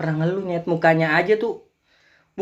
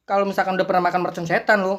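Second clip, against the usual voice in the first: ordinary talk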